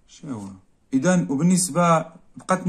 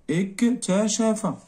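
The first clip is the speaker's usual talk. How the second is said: slow, higher dictation; اكتشف drawn out slowly, pitched up; 7 semitones higher, much slower than usual. Shown as a demonstration. Teacher dictating the verb to the class, slow and high.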